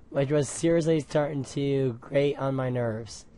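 ordinary delivery

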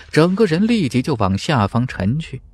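ordinary delivery, nothing marked

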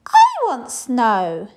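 high, loud, slow squeal. A narrator's reading. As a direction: squeal it like a demanding little girl. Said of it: "squealed the little princess".

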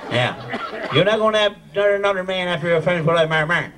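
ordinary talk

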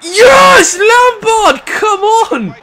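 high, loud triumphant yells; goal celebration shout. Real, not acted. Gamer cheers Lampard's goal, high loud triumphant shouts. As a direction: roar it out for the goal.

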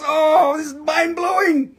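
high, shouted real amazement; amazed. Real, not acted. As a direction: blurt it out, genuinely blown away. Learning the viewer is from Texas.

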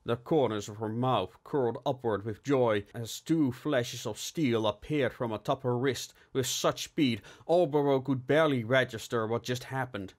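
usual speaking voice

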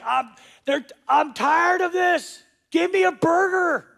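whiny complainer. Retold or imitated. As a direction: sulk like a spoiled kid tired of dinner. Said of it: "And he's like".

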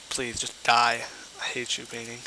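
ordinary delivery

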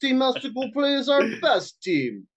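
hammed-up Russian accent, haggling. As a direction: put on a comic Russian accent. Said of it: "I could do a good Russian accent".

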